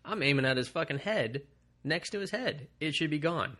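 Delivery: retold gamer exasperation; exasperated. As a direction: protest it like a cheated gamer. Retelling indignation at failed shotgun kill.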